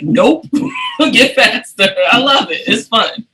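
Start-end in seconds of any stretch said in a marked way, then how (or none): 0.0-1.9 teasing. Retold or imitated.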